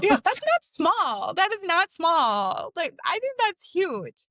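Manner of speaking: high, slow insistence on "not small"; emphatic protest. Real, not acted. Host insisting twenty-three thousand followers is not small, emphatic. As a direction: stretch "small" and "huge" with high, swooping emphasis.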